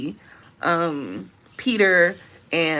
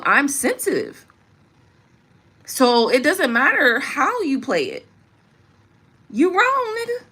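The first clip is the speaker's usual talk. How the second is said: impassioned plea; 5 semitones higher than usual. Real, not acted. A woman telling her man not to come at her, impassioned. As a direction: build it into a fervent plea.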